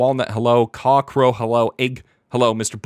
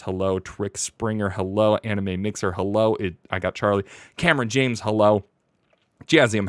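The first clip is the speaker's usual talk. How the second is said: usual speaking voice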